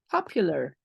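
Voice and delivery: usual speaking voice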